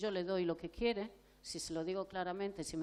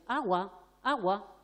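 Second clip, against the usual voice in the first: put-on adult trailing after the child repeating "Agua, agua"; nagging repetition; 6 dB louder, slower than usual. Shown as a demonstration. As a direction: keep pushing "Agua, agua" at him. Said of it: "no quiere decir que yo ande detrás de él".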